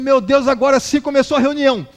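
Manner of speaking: mock a moderate shout; retold shouting. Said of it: "E ele gritando".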